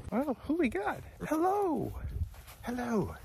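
high, soft pet-talk voice. Real, not acted. Man greeting llamas at the gate in high baby talk. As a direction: coo at the animals like babies.

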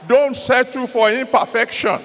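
fiery, commanding sermon voice; preaching. Acted. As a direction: call it out to the crowd.

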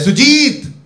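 high, loud call of the name; loud shouted name; shouting a name. Retold or imitated. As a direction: call out his name across the valley. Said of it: "मेरे पे ना नाम चिल्लाया".

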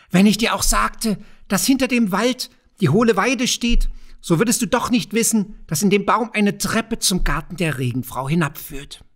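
a sneering kobold enjoying his secret; crafty goblin teasing the young farmer. A narrator's reading. Kobold taunts Andres.

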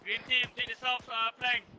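shouting orders over battle. Real, not acted. Battle, directing flank.

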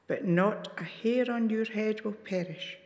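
ordinary delivery

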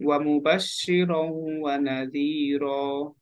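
slow, measured ওয়া মুবাশশিরাও ওয়া নাযীরা; unhurried model recitation. Shown as a demonstration. Teacher showing there is no need to rush, slow recitation. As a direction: draw out the long vowels without rushing. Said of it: "দ্রুত বলার দরকার নেই".

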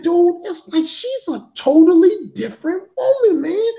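amazed friend. Retold or imitated. Friend's amazed remark retold.

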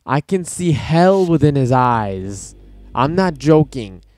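real shock, spoken loud and slow; loud shocked exclamation. Real, not acted. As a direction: blurt it out in horrified disbelief. Shocked by coach's dead eyes.

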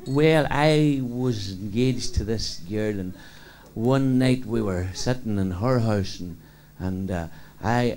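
slow, drawn-out telling; very slow speech. Acted. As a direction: take your time over every word. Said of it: "Riley spoke very slow".

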